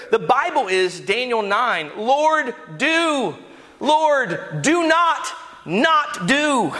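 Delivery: high, pleading cry to the Lord; impassioned preaching. Acted. Preacher quoting Daniel's prayer to the church, impassioned.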